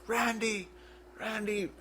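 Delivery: high-pitched imitation of a panicked call; mock cry for someone, far above your voice; distressed calling out. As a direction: call the name in a high, drawn voice. Retold brother calling out in shock.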